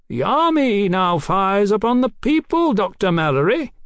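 high, projected coaxing. A narrator's reading. Captain tries a new tack on the doctor, coaxing. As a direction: coax an enemy from a distance. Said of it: "in a new and coaxing voice".

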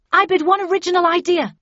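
high, loud shouted bid; shouting. A narrator's reading. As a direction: shout the bid over the crowd. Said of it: "I shouted".

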